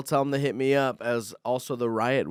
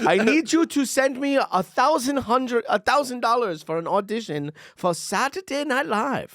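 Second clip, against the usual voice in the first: put-on Indian accent; 11 semitones higher, 4 dB louder than usual. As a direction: ham up a scam caller's Indian accent. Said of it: "the accent got better as it ended".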